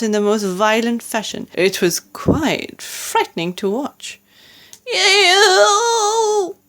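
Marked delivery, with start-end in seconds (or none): 4.9-6.6 high, drawn-out howling roar; giant's wailing roar. A narrator's reading. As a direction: roar it out long and high, far above your own voice. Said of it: "roared the flesh lump".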